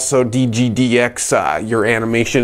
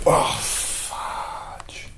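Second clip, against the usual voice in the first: whispered oops; 11 dB quieter than usual. Real, not acted. Mutters after flubbing intro.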